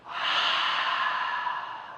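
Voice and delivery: long breathy H exhale; Ujjayi breath. Shown as a demonstration. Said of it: "so do it with me".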